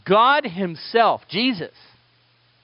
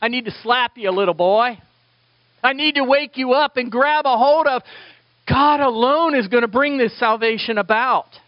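higher, louder commanding call; God calling Peter; 7 semitones higher, 3 dB louder than usual. Retold or imitated. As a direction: grab Peter's attention firmly. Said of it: "God the Father reaches down and goes".

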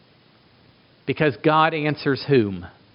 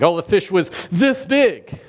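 mock bragging; 4 semitones higher than usual. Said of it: "you brag".

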